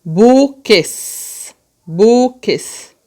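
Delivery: BUQUES slowly split as bu-ques; separated syllables. Shown as a demonstration. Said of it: "aqui já estão sílabas separadas".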